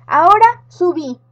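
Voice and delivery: loud, high command; girl orders the witch, loud and bossy. Acted. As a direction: order her up the slide.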